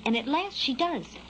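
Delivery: usual speaking voice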